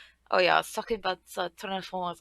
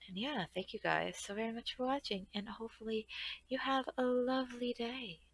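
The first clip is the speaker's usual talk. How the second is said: soft sign-off voice; 2 semitones higher, 9 dB quieter than usual. Real, not acted. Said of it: "I switched into this voice".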